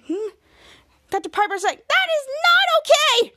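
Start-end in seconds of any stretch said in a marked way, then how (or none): 1.9-3.3 high, loud squeal of protest; outraged yell. Acted. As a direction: protest at the top of your voice. Piper outraged by the dogs.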